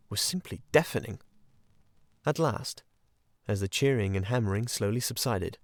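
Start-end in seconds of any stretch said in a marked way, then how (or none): none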